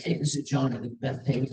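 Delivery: a hint of a would-be seducer; cool sexy voice. Caller on a phone dating line, faking a cool, sexy voice. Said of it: "that was my cool, sexy voice".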